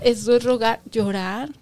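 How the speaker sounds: ordinary talk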